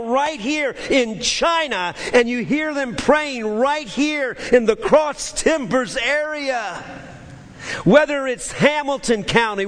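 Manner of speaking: high, impassioned preacher's cry to God; fervent preaching prayer. Acted. Preacher praising the all-hearing God before congregation, fervent and high. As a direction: build into a high, stretched-out litany of praise.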